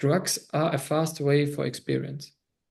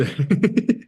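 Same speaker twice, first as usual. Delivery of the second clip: burst of laughter; 3 semitones higher, 6 dB louder than usual. Real, not acted. Laughs at the reply.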